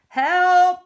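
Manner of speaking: high, loud, drawn-out yelp; acted frightened cook calling out; scared cry for help.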